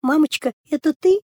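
small, high timid voice; a frightened girl asking in a tiny high voice; thin little voice. Acted. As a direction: ask very high and timid through the door. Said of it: "тоненьким голоском спрашивает".

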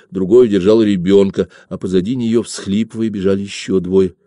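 usual speaking voice

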